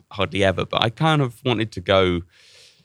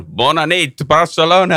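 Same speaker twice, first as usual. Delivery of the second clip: loud imitation of a frontman's greeting; stage greeting shout; 6 semitones higher, 6 dB louder than usual. Lead singer greeting Barcelona audience, imitated stage shout. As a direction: call out to the crowd, building louder and higher.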